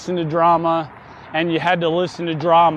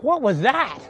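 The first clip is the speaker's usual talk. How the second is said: lightly voiced mom, pitched higher; mom's startled question; 5 semitones higher than usual. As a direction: ask it higher, imitating a puzzled mother. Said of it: "my mom was like".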